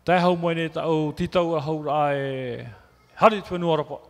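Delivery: ordinary delivery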